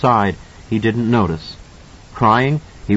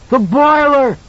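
put-on high, loud child's scream; boy screams at the monster; 5 dB louder, slower than usual. A narrator's reading. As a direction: shriek the sudden realization. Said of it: "Danny screamed".

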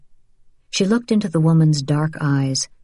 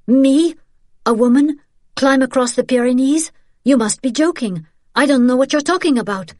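high, loud mock disbelief; incredulous denial; 8 semitones higher, 4 dB louder than usual. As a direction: protest at a high pitch, rushing at the end. Feigns disbelief to deny accusation.

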